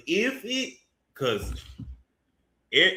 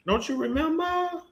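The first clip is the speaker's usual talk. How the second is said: high, teasing sing-song; mock her with a high taunt; high sing-song jeer at Malika. Taunting Malika, repeated question.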